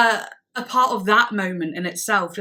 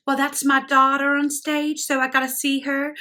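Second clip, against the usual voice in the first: terrible put-on accent, high and loud; Juliet's accent; 6 semitones higher, 4 dB louder than usual. Proud mum explaining herself to the crowd, badly imitated accent. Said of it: "Sorry, that's terrible accent".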